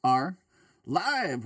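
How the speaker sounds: drawn-out show opener, spaced word by word; Cog impression. Host opens the live show for everybody, Cog impression. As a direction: do the co-host's intro, landing big on the last word. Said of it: "pretty good Cog impression for your intro".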